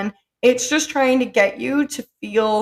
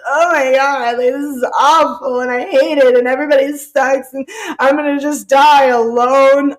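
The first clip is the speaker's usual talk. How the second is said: louder, melodramatic lament; hammed-up despair, hitting the words hard; mock frustrated despair; 5 dB louder than usual. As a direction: imitate a friend wallowing in self-pity. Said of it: "getting so frustrated".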